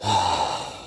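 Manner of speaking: long, low exhale; sigh. Real, not acted. Man pausing after his hail to Lucifer, long sigh. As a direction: let out a long breathy sigh. Long breath out between remarks.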